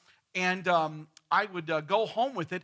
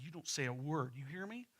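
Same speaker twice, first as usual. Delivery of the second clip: softer, low-voiced scolding; stern hushed warning; 4 semitones lower, 11 dB quieter than usual. Wife quietly ordering husband to keep silent, softer. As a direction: hiss the order at him under your breath. Myrtle hushing Fred.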